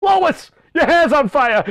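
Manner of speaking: loud, high cartoon alarm into cackling; high, hammed-up yell and laugh; Peter. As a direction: shout it as a panicked cartoon husband, then laugh. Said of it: "Oh, Lois".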